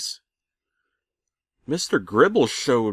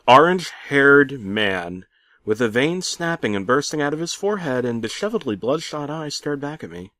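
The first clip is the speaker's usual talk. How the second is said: plain reading voice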